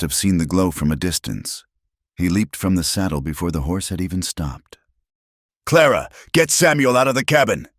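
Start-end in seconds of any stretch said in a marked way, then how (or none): 5.6-7.7 high, loud urgent order; commanding yell to get the boy out. A narrator's reading. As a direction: shout it over the roaring fire. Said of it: "he yelled".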